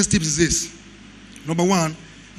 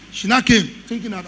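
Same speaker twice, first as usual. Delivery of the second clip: ordinary talk, nothing marked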